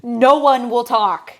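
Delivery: hammed-up slow, loud telling-off; a mock stern order, dragged out; mock furious guide. Exaggerating the guide silencing the tour, mock-furious and loud. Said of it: "he really was like".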